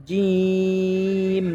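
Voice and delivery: জিম drawn out long; letter demo. Shown as a demonstration. Teacher rereading the first line to students, drawn out. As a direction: stretch জিম slowly. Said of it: "প্রথম লাইনটা আবারও পড়ব".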